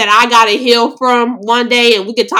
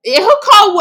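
disbelief; 11 semitones higher, 4 dB louder than usual. Retold or imitated. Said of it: "I was like".

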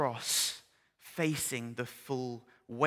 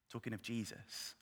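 usual speaking voice

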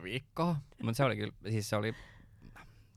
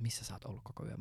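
whispered question; 8 dB quieter than usual. Retold or imitated. Friend asks at the door.

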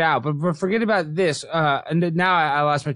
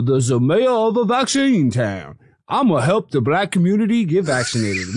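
hammed-up mayor hyping the community; put-on mayor voice. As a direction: play the vaccine-town mayor for laughs. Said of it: "I don't know what voice I'm doing".

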